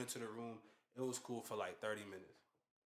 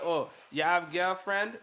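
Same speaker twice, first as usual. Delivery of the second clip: flirty Jamaican woman; 8 semitones higher, 11 dB louder, slower than usual. Retold or imitated. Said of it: "And she was like".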